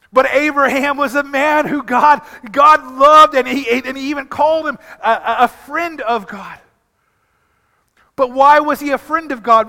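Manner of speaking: loud, high preaching build; fervent sermon, voice climbing; preaching at full force. Acted. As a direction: lift the congregation with it.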